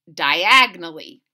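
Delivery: usual speaking voice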